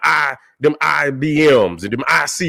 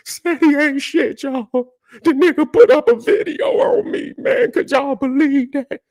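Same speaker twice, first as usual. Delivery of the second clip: much higher, whiny mock of the critic; sing-song mock outrage, pitched way up; 12 semitones higher than usual. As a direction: whine it like he's crying to the chat. Mimicking a whining critic.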